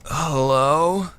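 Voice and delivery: low, drawn-out phone hello; faking wide awake. Acted. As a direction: answer low, trying to sound long awake. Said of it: "answer the phone with my best".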